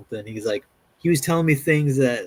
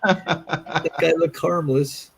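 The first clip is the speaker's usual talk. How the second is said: higher, laughing through a mock quote; 5 semitones higher, slower than usual. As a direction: giggle while imitating the naive kid. Mocking quote of young people.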